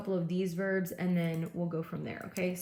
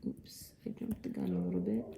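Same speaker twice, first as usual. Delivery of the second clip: a quick whispered oops under the breath; whispered aside; 13 semitones lower, 7 dB quieter than usual. Real, not acted. Teacher catching her own slide mistake, whispering. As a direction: whisper it to yourself, unvoiced.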